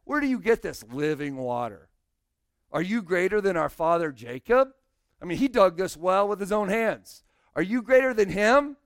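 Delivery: loud, mocking challenge rising to the end; sarcastic. Pastor voicing the woman mocking Jesus, loud sarcasm. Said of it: "she sounds extremely sarcastic".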